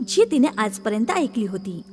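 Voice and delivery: plain reading voice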